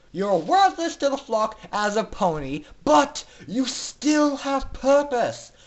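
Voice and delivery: high, loud, ecstatic villain speech; gleeful announcer. Acted. Announcer addresses captive foals from podium, gleeful and loud. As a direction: proclaim it like a delighted tyrant. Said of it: "began speaking loudly and clearly".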